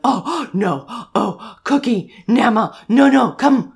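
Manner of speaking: put-on little boy at play. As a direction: call out like a kid in a pretend rescue. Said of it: "he'll be like".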